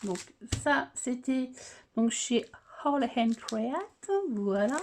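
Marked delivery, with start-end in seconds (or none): 2.8-4.1 very slow, drawn-out English accent. Shown as a demonstration. Vlogger repeating the shop's name, showy English accent. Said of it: "vous avez vu cet accent?".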